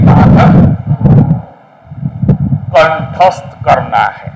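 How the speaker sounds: very slow, deliberate phrases with long pauses; emphatic dictation. Real, not acted. Teacher telling students which articles to learn by heart, slow emphatic dictation. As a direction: spell out the rule phrase by phrase, slowly. Teacher gives the rule to memorise.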